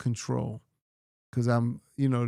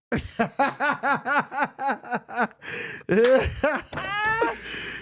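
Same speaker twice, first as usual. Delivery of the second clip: long laughing fit; 10 semitones higher than usual. Real, not acted. Joke about mind talking.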